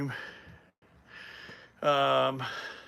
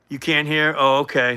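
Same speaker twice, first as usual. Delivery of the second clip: louder, checking they can hear; raised voice; 2 semitones higher, 9 dB louder than usual. Real, not acted. Streamer to viewer who can't hear, raised voice. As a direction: speak up for a listener.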